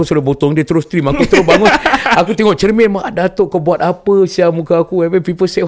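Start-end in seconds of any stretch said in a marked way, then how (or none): 2.9-5.0 retold shock at the mirror; half-joking exclamation; mock shocked.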